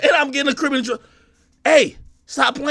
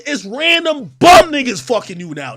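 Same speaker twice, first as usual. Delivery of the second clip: ordinary talk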